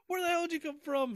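high, fast startled question; retold astonishment. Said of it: "they're like".

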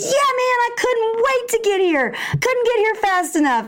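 put-on high upbeat kid voice; eager boy. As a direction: act cool for your friends. Son brave despite tears.

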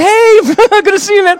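high, loud hello broken by laughter; laughing greeting. Retold or imitated. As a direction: greet an old foe through a laugh. Acts out awkward greeting years later.

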